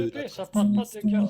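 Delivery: loud, insistent interruption; forceful objection over the others. Real, not acted. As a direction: cut in hard to make the point. Heated interruption in the debate.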